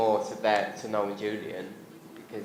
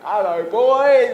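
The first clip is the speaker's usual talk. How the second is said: much higher, much louder, slow Cockney greeting; Cockney granddad; 12 semitones higher, 12 dB louder, much slower than usual. Retold or imitated. Granddad greeting grandkids at the door, loud slow Cockney. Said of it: "my granddad spoke like that".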